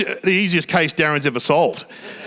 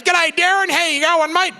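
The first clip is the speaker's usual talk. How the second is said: high-pitched, over-eager dad hailing someone; excited greeting; 13 semitones higher than usual. Retold or imitated. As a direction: call out a delighted, high-pitched mock greeting. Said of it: "He's so excited".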